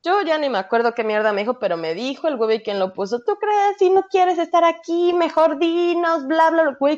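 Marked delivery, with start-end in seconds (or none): 3.2-6.8 retold yelling. Aunt scolding her niece outside school, high imitated yell. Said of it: "me grita esta pendeja".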